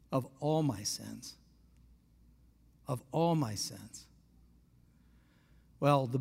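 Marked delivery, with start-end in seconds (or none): 0.1-1.3 tender. Retold or imitated. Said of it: "so hard for me was to believe". 2.8-4.1 tender. Retold or imitated. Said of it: "so hard for me was to believe".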